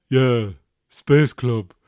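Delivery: put-on teen son insisting, drawn out; sulky teenage son. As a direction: stretch it out like a bored teenager. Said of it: "Why are you talking like that?".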